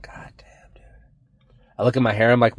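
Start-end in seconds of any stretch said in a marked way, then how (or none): 0.0-0.8 breathy whispered curse. Retold or imitated. Muttering to himself in the mirror, whispered. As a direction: hiss it under your breath. Mock dismay at own lips.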